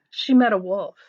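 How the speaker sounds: ordinary delivery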